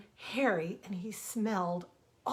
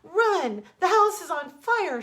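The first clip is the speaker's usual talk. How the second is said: high, much louder panicked yell; frantic shout; 9 semitones higher, 11 dB louder, slower than usual. Acted. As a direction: scream a warning to her son. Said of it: "she shouted".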